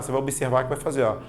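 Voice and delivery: usual speaking voice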